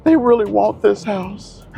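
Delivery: high falsetto whiny plea; mock crying agent. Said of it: "literally crying".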